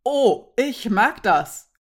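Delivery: loud, slow "Oh" of realisation, starting high; exclaimed repeat. A narrator's reading. Nurek repeats her exclamation after mulling it over, slow and emphatic. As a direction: savour it slowly and emphatically. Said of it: "wiederholte auch dies".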